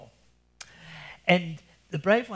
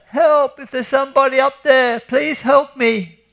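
yelling for help; 11 semitones higher, 12 dB louder than usual. Acted. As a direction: scream up the hole, dragging out the plea. Mabruk stuck yells from hole.

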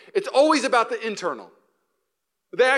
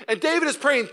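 ordinary delivery

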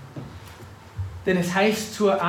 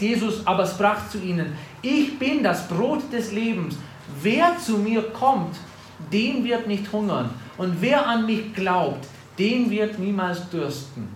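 higher, slow, full-voiced preaching; preaching scripture; 4 semitones higher, 3 dB louder than usual. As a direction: proclaim Jesus' words with weight. Preacher reads scripture aloud.

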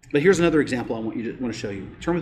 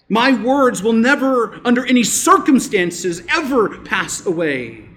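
slow, loud "never" then "ever"; emphatic preaching; 6 semitones higher, 5 dB louder, slower than usual. Acted. Pastor stressing the promise to the church, slow and loud. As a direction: drive home never and ever.

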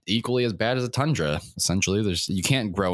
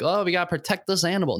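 mocking conservationist; 10 semitones higher, faster than usual. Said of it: "I don't get that whole, like".

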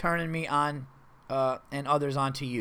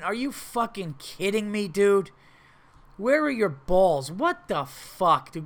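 incredulous; 4 semitones higher, slower than usual. Real, not acted. Host berates the fake-crying coworker.

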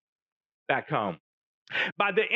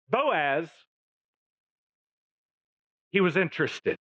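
ordinary talk, nothing marked

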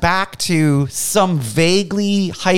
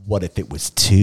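low gruff Pope voice; 10 semitones lower, 3 dB quieter than usual. Retold or imitated. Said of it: "the Pope's like".

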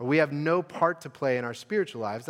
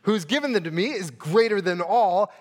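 louder, higher, slowing on greater than all; emphatic declaration; 5 semitones higher, 8 dB louder than usual. Shown as a demonstration. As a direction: proclaim it with rising force. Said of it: "So Jesus says".

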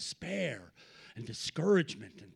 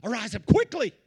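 louder urgent command; 5 semitones higher, 7 dB louder than usual. Shown as a demonstration. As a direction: call it out, urgent. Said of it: "repeated command".

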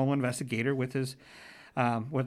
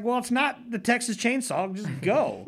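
exasperated dad; 9 semitones higher, 4 dB louder than usual. Retold or imitated. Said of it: "it's like".

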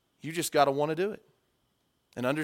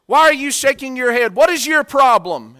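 higher, louder irritated challenge; annoyed confrontation; 9 semitones higher, 11 dB louder than usual. Retold or imitated. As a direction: call out the kid who won't stop shaking his head. Speaker confronts heckling kid.

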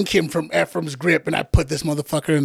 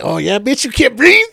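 mock gloating taunt pitched up and louder; taunting, rising; 5 semitones higher, 6 dB louder than usual. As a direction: gloat over him, climbing high.

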